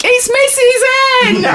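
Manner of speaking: high, drawn-out public shout; imitated yell. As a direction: yell it out like an excited passer-by. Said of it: "somebody yells out in somewhere public".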